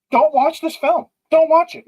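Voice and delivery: high, loud don't watch it; emphatic warning. Real, not acted. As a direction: plead with them to stay away. Warning viewers off the film.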